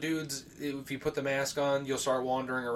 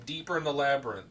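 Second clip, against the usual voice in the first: normal talking voice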